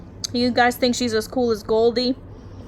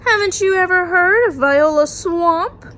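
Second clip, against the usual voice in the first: louder, higher teasing warning; spooky big kid; 8 semitones higher, 10 dB louder than usual. Acted. Big kid scaring Miss Nelson's class about Viola Swamp, loud and high. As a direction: spook them with the name.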